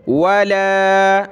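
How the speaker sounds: वला with the ला held for one alif length; stretched vowel. Shown as a demonstration. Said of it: "एक अलिफ की limit में ऊपर खींचना है". (वला में ला को एक अलिफ खींचें)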